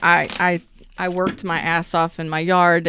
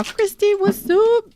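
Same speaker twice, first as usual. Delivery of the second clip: high, bright call-out; excited greeting; 13 semitones higher, 4 dB louder than usual. Real, not acted. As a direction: sing out the hello.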